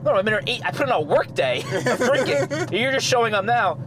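high-pitched imitation of a fed-up friend; exasperated retold protest. Friend fed up with late Norm.

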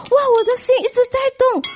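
high, fast, breathless amazement; excited wonder. Retold or imitated. Woman at her stove, asking what is stirring her heart, high and fast. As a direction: blurt it out, amazed and quick.

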